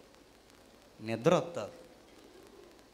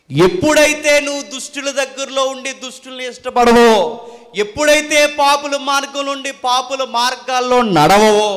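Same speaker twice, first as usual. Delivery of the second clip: raised, rhythmic pulpit cadence; forceful preaching; 7 semitones higher, 11 dB louder than usual. Real, not acted. Preacher warning against the company of the wicked, forceful rhythmic preaching. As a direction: punch each parallel clause. Builds parallel sermon clauses.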